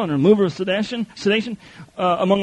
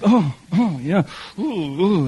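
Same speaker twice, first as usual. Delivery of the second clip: mock swooning; slower than usual. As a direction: melt at the flattery. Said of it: "it's putting goosebumps on your back".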